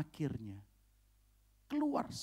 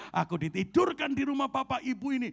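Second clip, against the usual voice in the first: higher, louder repeat of "ditidurkan"; loud declaration; 11 semitones higher, 7 dB louder than usual. Retold or imitated. Pastor answering the dinner-table question, loud and proud. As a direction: announce it boldly to the whole table. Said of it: "Dengan lantang saya bilang".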